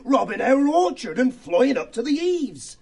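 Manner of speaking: high, eager little mouse. Acted. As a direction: rattle off the plan with glee. Church mouse voiced, eager explanation.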